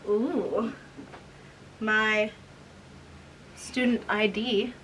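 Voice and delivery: ordinary delivery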